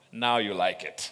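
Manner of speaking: ordinary delivery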